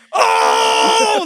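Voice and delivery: loud, low crowd "oh"; crowd roar "oh". Retold or imitated. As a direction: roar like the whole crowd erupting. Crowd erupting in battle.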